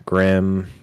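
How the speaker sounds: ordinary delivery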